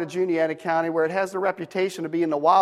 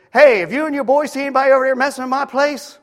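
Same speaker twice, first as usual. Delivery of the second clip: hammed-up country neighbor, higher, louder and fast; country boy; 6 semitones higher, 5 dB louder, faster than usual. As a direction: play the rough neighbor barking a question. Neighbor voiced in the story.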